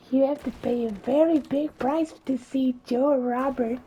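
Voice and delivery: high, loud cackling hag; witch voice. Acted. Witch names the price to Cinderella, high and loud. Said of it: "witch keeps talking".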